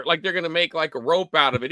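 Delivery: normal talking voice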